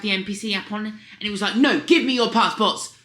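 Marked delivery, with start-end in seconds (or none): 1.6-2.9 booming, slower American border officer; loud American voice. Retold or imitated. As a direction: bark it out in a loud, higher American voice. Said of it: "in his American loud voice".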